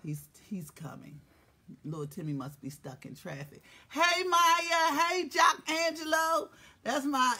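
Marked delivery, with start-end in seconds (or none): 0.0-3.6 whisper. Real, not acted. Whispered update to viewers.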